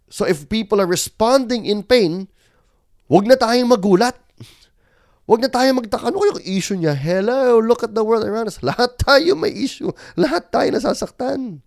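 loud, high, impassioned preaching; preaching at full force. Acted. Pastor pleading with his congregation, loud and fervent. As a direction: lift the whole room with rising fervor.

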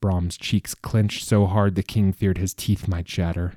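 ordinary delivery

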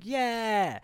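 high, soft drawn-out cheer; small-scale victory cry; mock triumph. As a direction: imitate a hero soaking up applause. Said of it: "and he's like".

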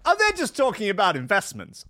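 high, breezy excuse; mock dismissive. Excusing a friend's Epstein visit, high and breezy. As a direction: brush it off lightly. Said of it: "I'd be like".

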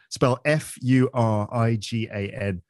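ordinary talk, nothing marked

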